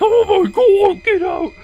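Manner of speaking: very high, loud, slow panicked shriek; panicked scream. Retold or imitated. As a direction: scream her out of the bathroom in horror. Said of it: "She has a heart attack".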